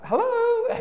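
very high, stretched hello with a laugh; high sing-song hello and giggle; calling through door. Retold or imitated. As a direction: call up to the window, teasing.

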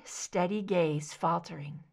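plain reading voice

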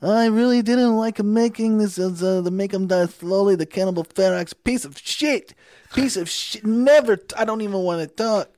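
high, loud, slow mock Italian accent; Italian accent impression. [name] imitating Giovanni trashing Cannibal Ferox, Italian accent. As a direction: rant it in a thick Italian accent. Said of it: "he has this incredible accent".

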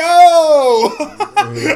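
high, loud whooping laugh; real laughing fit; loud burst of laughter. Real, not acted. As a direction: crack up hard at the joke. Laughs at the mocked name.

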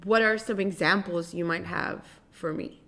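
normal talking voice

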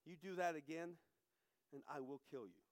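low, soft menacing threat; a father's quiet, low threat. Retold or imitated. Drunk father quietly threatening his young son. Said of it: "and in a low voice".

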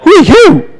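very high, amazed who are you; neighbor's high incredulous question; astonished neighbor. Retold or imitated. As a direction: squeak it out in disbelief. Neighbor amazed at the special treatment.

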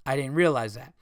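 ordinary talk

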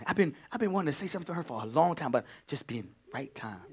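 ordinary delivery

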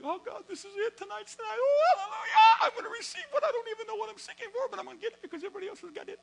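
very high, shouting, laughing elation; hammed-up eager believer crying hallelujah; ecstatic. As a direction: shout for joy, sure tonight's the night. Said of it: "I say".

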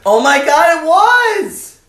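high, loud burst of revelation; mock amazed cry. Host seizing on the Jesus joke, amazed cry. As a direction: cry out as if struck by the truth.

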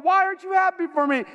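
high-pitched, wounded complaint; baffled protest. Retold or imitated. Golfer to his laughing partner, baffled. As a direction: plead in comic bewilderment, pitch high.

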